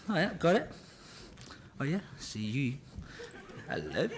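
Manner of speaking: soft private chatter under his breath; talking to himself. Retold or imitated. Actor alone inside the mask, softly chatting to himself. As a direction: murmur it as if nobody can hear. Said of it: "So I talk to myself in the mask".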